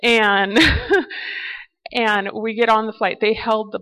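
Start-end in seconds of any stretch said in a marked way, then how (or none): 0.6-1.6 high laugh. Real, not acted. Laughing at holding the plane.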